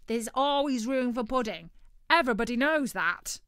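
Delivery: loud, high, insistent bird voice; greedy turkey, sure of himself. Acted. Turkey argues loudly.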